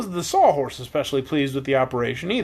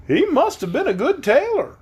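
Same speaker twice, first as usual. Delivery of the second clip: high, lightly voiced straw man; Scarecrow voice; 12 semitones higher, 3 dB louder than usual. A narrator's reading. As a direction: pitch it up for the Scarecrow.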